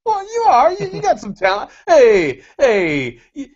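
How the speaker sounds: high put-on mother reassuring her boy; mock mom voice. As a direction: play his mom cooing praise at her kid. Mock-motherly praise of friend.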